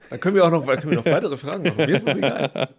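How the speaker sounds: giggly after whisky; tipsy laughing. Real, not acted. Tipsy, laughing, getting louder.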